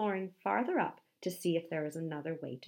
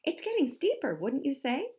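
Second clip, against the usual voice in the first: high, louder cheerful question; thrilled by the challenge; excited; 8 semitones higher, 5 dB louder than usual. A narrator's reading. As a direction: ask it with eager delight. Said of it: "excited at this new challenge".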